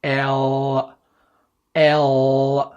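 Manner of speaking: long, held "L." with a dark el sound; drawn-out English L. Shown as a demonstration. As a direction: stretch "L." out for a learner to repeat. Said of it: "اعمل كده وردد ورايا وقول".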